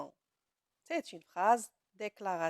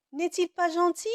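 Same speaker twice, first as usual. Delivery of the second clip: rising question, raised voice; question tune; 7 semitones higher, 7 dB louder than usual. Shown as a demonstration. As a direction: ask it as a real question. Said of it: "Que fait cette phrase?". (intonation interrogative marquée)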